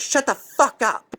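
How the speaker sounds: high-pitched mock raging woman; Sarah. Woman threatening David after he refuses, high-pitched crazed rage. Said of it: "She goes".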